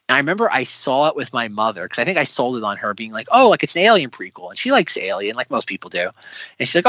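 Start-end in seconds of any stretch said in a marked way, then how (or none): 3.3-4.5 higher, louder retold sales pitch; lightly acted enthusiasm; eager pitch. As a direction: talk someone into it with mild excitement. Retells selling film to mother.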